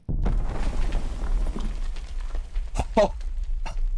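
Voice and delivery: long, airy laughing fit; breathy real laughter that keeps going. Real, not acted. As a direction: crack up helplessly, almost without voice. Cracks up at the game.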